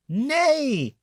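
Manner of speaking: whinny like a horse; horse neigh. A narrator's reading. Reading to children, a high horse neigh. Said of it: "said the horse".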